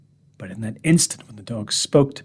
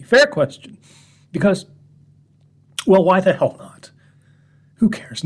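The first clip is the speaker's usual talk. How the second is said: defiant shrug; 6 semitones higher, 5 dB louder than usual. Acted. Defiant rant to the gentlemen.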